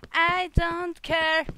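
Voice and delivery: slow, even robot voice. Real, not acted. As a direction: drag it out like a robot. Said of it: "I sound like a fucking robot".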